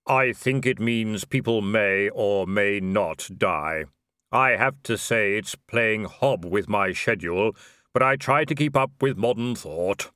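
drawn-out reaper musing; grim reaper voice. Acted. Death speaking.

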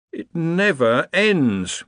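slow, high, weary groan; weary Darwin. A narrator's reading. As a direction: sigh it out exhausted.

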